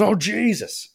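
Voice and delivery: high-pitched retold cry of alarm; startled, pitch leaping up; alarmed exclamation. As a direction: act out a driver's sudden shock. Driver realises he's on wrong side.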